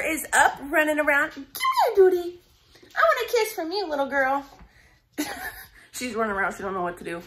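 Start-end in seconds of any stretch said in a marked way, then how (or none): 1.3-4.4 high cooing pet voice. Real, not acted. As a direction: coax the puppy sweetly. Cooing to the dog.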